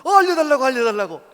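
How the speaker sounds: loud, high, eager plea; eager begging. Retold or imitated. Pastor eagerly begging the farmer for the secret. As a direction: beg for the secret like you can't wait.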